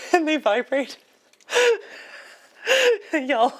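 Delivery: words squeezed out through high laughter; laughing helplessly. Real, not acted. Amused by the vibrating plushie, talking through laughter. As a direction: laugh through the line, barely holding it.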